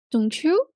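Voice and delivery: "don't you" climbing high on "you"; rising tag question. Shown as a demonstration. Teacher models confirming a park plan, high rising "don't you". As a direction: swing "you" sharply upward, asking for confirmation. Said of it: "So a rising intonation".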